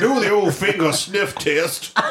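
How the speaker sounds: hammed-up Georgian put-on, amused; Georgian accent. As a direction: keep the Georgian accent through giggles. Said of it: "everybody in the show has that accent".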